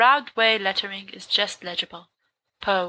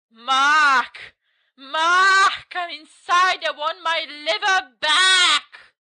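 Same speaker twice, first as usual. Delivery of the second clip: high, loud ghostly call with stretched vowels; spooky calling voice; 7 semitones higher, 4 dB louder, much slower than usual. Acted. Voice inside the house hunts Mark, high eerie wail. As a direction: wail the demand, drawn out. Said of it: "then the same voice says".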